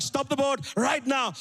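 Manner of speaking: acted angry parental command; imitated angry dad. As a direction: spell it out slowly as an angry dad. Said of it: "He's getting angrier".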